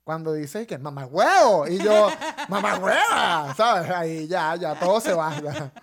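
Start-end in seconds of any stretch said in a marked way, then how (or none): 0.8-1.7 loud mock Venezuelan "Mamá huevo"; loud imitation of someone's Venezuelan slip; imitating Venezuelan accent. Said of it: "tiras pa' un ladito y tú tiras". 2.5-3.5 loud, drawn-out Venezuelan "Mamá hueva"; hammed-up, laughing Venezuelan accent on "Mamá hueva"; exaggerated Venezuelan accent. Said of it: "tú tienes un acento neutro venezolano".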